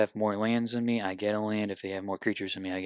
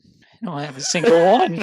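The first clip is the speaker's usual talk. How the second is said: exasperated outburst; 8 semitones higher, 16 dB louder than usual. Real, not acted.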